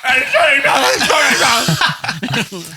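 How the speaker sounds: high loud whiny apology; wailing apology. Retold or imitated. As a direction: bawl it out like a tearful son. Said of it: "And Chris is like".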